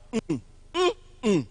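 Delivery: teacher humming a middle-stressed word's rhythm; hummed stress pattern. Shown as a demonstration. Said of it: "just make the sound".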